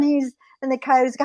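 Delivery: ordinary delivery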